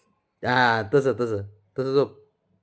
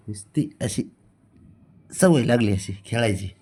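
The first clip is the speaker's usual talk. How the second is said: usual speaking voice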